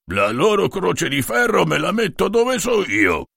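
gruff, slow soldier's grunt. A narrator's reading. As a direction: grunt it out with contempt. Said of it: "grugnì Fratellino".

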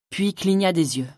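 ordinary delivery, nothing marked

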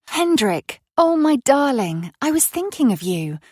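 bright high burst of delight at his call; light up on hearing her darling; breathless happy greeting; joyful surprise. Acted. Lovers reunite on the phone.

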